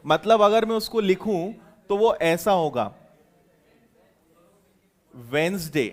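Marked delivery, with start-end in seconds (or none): 5.1-5.9 वेंसडे, two syllables, silent d, a bit softer; correct pronunciation. Shown as a demonstration. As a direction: say वेंसडे clearly the English way. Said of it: "ऐसा उसको हम actually pronounce करते हैं।". (वेंसडे का सही उच्चारण, धीमे से)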